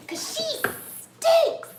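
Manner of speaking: loud, very high, drawn-out tease; stretched high-pitched mock complaint; teasing pet voice. Real, not acted.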